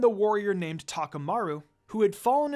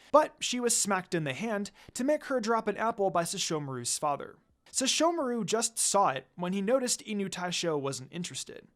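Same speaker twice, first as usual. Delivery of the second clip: usual speaking voice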